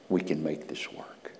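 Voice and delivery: soft reassuring whisper; the Lord reassures Isaiah in a whisper; gentle whisper. Retold or imitated. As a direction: whisper comfort to him.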